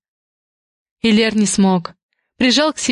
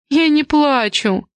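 slow, higher indignant denial; indignant protest; 5 semitones higher, slower than usual. A narrator's reading. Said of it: "возмутилась Веста".

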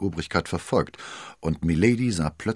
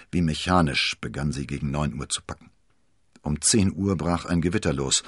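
plain reading voice

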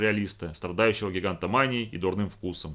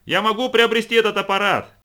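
shouted high and loud over the din; shouting; 11 semitones higher, 9 dB louder than usual. A narrator's reading. Uncle shouting over the noisy machine, loud yell. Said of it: "кричал Брэд".

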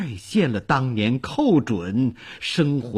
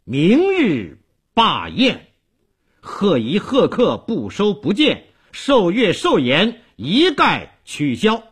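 raised, emphatic proclamation in measured phrases; decisive announcement; 7 semitones higher, 4 dB louder than usual. A narrator's reading. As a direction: lay down the order firmly. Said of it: "断然宣布".

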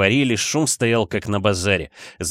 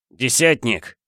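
mild narrated call-out; крик; 2 semitones higher, slower than usual. A narrator's reading. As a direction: call for a subordinate, only slightly raised. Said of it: "крикнул Егор в пространство". (негромкий окрик)

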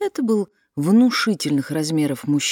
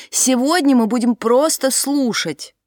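high, loud call over wind; shouting; 8 semitones higher, 4 dB louder than usual. A narrator's reading. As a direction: keep shouting through the wind. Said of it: "прокричала в ответ Дилейни".

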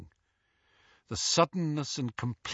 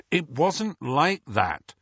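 slow, tight-jawed denial; Harry restraining frustration through gritted teeth; 6 semitones higher, 4 dB louder, slower than usual. A narrator's reading. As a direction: grit your teeth and hold back anger. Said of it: "said Harry through gritted teeth".

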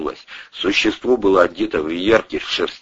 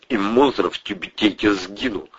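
usual speaking voice

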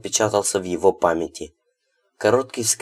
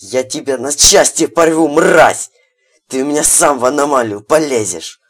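furious yelling; 6 semitones higher, 8 dB louder than usual. A narrator's reading. Enraged threat.

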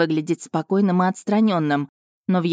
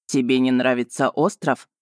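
insolent smirk; 4 semitones lower than usual. A narrator's reading. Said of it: "нагло усмехнулся дракон".

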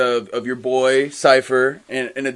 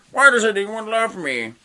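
high-pitched self-pitying whine; hammed-up woe-is-me complaint; mock lament; 8 semitones higher than usual. Acted lament of character.

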